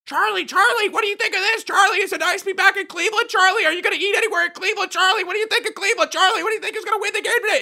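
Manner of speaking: very high, fast, loud mock reporters firing questions; squeaky pack of reporters crowding him; shrill reporter swarm. Media swarm shouting questions at Charlie Sheen, squeaky and fast. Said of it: "everyone was like".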